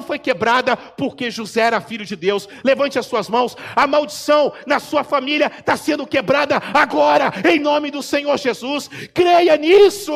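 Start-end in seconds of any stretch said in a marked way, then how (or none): 2.6-8.9 loud, high, rising preacher's call; fiery preaching. Acted. Preacher rousing congregation. 9.1-10.1 loud high cry of believe it; shouted command. Acted. Preacher commands belief, then repeats.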